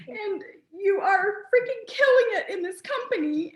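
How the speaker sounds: high and crying, pushing the praise through. Real, not acted. Host crying while praising Jackie's success. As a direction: cry it out but keep going. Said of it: "I wasn't gonna cry".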